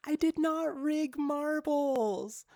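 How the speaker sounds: loud, high, drawn-out denial; indignant protest. Real, not acted. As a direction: protest your innocence slowly and loudly. Protesting an accusation from chat.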